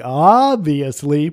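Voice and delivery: obviously dragged out for emphasis; slow, emphatic obviously; drawn out slowly. Real, not acted. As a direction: stretch obviously slowly, each syllable long. Repeats word, second drawn out.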